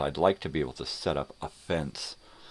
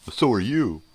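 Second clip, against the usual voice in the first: high, loud taunting playground voice; mock comeback; 9 semitones higher, 9 dB louder than usual. Mocking a commenter's lame comeback, in a high, taunting voice. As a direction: taunt it like a schoolkid. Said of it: "I'm sure I'll hear some really".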